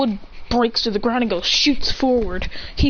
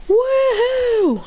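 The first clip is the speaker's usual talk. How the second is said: high, long thrilled whoop; gleeful whoop; 14 semitones higher, 4 dB louder than usual. Acted. They start to slide again, gleeful whoop. They cheer while sliding.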